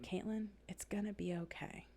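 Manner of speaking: soft whispered reassurance, half voiced; retold whisper. Director whispering comfort to a stressed coach. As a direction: whisper it gently like a kind director. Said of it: "And she literally said".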